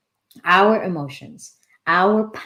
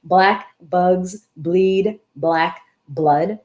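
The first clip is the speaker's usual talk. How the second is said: slow, deliberate demo; much slower than usual. Shown as a demonstration. Host showing viewers the phrase to try, slow and word by word. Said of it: "is try and say".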